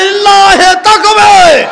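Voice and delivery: high, drawn-out full-force shout. Real, not acted. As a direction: roar it to rouse the crowd. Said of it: "আরো জোরে".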